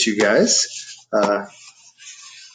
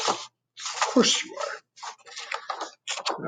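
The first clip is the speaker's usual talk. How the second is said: slow breathy whisper, almost to itself; whispered aside; 7 dB quieter, much slower than usual. Acted. Teacher to kids, slow whisper.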